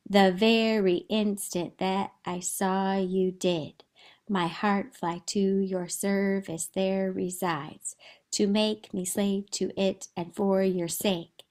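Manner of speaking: slow, measured reading of Shakespeare verse; poetry reading. Acted. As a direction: read the lines slowly, as verse. Reads Ferdinand's verse aloud.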